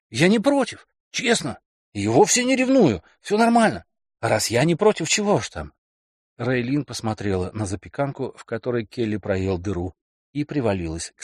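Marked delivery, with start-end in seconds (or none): 0.1-5.7 high, wheedling man; coaxing boyfriend. Acted. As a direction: talk her into it lightly. Kelly reassuring.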